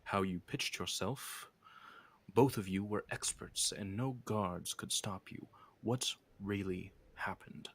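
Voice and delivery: slow, soft interrogation fading into a whisper; quietly menacing questioner. Acted. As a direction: press for the truth slowly and quietly. Game master voices the questioner.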